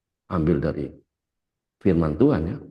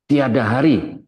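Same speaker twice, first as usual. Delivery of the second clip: louder, higher preacher's slogan; rallying cry; 6 semitones higher, 5 dB louder than usual. Real, not acted. Preacher closing his message to the congregation, rallying cry. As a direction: ring it out to rouse the congregation.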